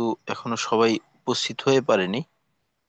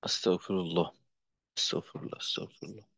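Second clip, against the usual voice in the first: fading to a murmur; softly seek forgiveness under the breath; whispered astaghfirullah, dying away; whispered prayer; 3 semitones lower, 9 dB quieter than usual. Real, not acted. Muttered after clearing throat.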